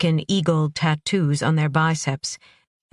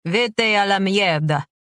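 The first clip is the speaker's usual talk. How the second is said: put-on drunken shout; 3 semitones higher than usual. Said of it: "shouted a second man".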